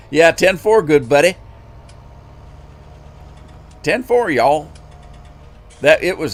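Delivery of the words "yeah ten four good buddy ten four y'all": loud, higher Southern trucker sign-off; hammed-up Southern radio lingo; Southern accent. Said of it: "everybody has a Southern accent on the road".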